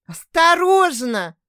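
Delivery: high, loud, slow cry of "Осторожно!"; exclaimed warning. Acted. Grandmother warning daughter not to drop babies, alarmed exclaim. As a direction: warn sharply before they fall. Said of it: "воскликнула Рената".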